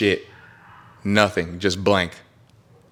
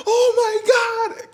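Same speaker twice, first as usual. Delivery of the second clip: high, slow, drawn-out cry; re-enacted terror; much slower than usual. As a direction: cry out as if plunging from the sky. Acts out terror at the drop.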